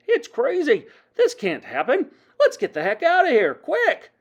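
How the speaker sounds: loud, high cries of alarm; panicked shouting. A narrator's reading. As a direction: yell like someone fleeing in panic.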